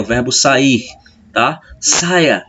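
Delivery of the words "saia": a high, falling order "saia"; command. Shown as a demonstration. Teacher showing the imperative: a sharp "saia". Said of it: "Você tá dando a ordem lá no modo imperativo".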